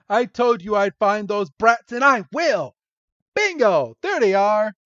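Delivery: high, loud hammed-up villain boast; mock triumphant boasting. Jessie spotting the kids after complaints, mock triumphant boasting. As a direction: crow it loudly, imitating a cartoon schemer.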